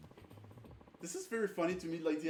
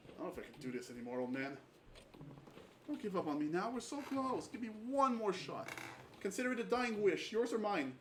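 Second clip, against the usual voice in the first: desperate game hero; 5 semitones higher than usual. Acted. Voicing game dialogue.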